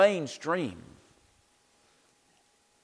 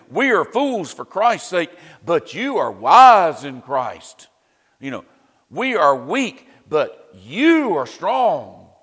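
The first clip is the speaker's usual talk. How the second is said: slow, louder, heavy mock praise; sarcastic; 8 dB louder than usual. Paul mocking the boastful Corinthians, biting sarcasm. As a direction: lay the sarcasm on thick, dragging every contrast. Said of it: "Paul gets a little sarcastic here with them, uh, very sarcastic".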